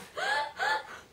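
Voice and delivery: high squealing laugh at her own slip; burst of laughter. Real, not acted. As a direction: crack up at your own mistake.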